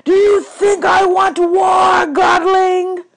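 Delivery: furious high bellow of a god. A narrator's reading. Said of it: "Hades bellowed".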